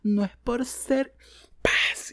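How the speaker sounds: high, slow mock setup; mocking quote. Mocking the online hypocrite, high slow mock voice. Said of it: "Es como que".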